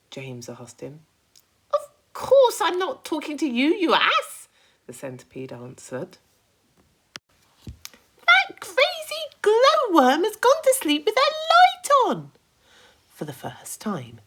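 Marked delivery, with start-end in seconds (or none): 1.7-4.5 high, loud fussy creature voice; a bossy high-pitched centipede nagging. Acted. Centipede fussing at James over his boots, high and loud. 8.3-12.3 high, loud fussy creature voice; a bossy high-pitched centipede nagging. Acted. Centipede fussing at James over his boots, high and loud.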